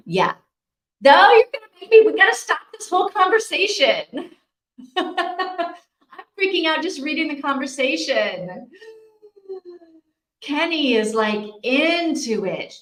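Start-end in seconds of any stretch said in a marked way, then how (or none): 1.0-8.7 high, loud, fast squeal of panic; real nervous shriek, words tumbling out fast; freaking out. Real, not acted. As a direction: yelp it out like the talk is really scaring you. Freaked out by horror talk.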